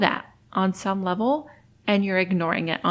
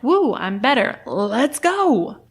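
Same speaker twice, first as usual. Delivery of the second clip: bright, eager burst of relief; mock excited cheer; 3 semitones higher, 5 dB louder, slower than usual. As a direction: cheer as if suddenly cured. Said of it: "I'm like".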